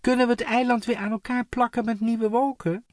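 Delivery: high, loud question across a gap; called question. A narrator's reading. Floor asking the distant imp, high loud call. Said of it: "riep ze naar de imp".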